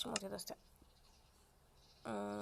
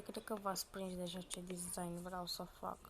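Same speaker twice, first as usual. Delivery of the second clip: ordinary talk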